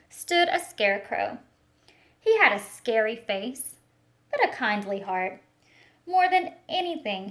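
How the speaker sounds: put-on expressive picture-book reading for children; storytelling read-aloud. Teacher reading a picture book to the class, storytelling voice. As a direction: paint the story for a class of little ones.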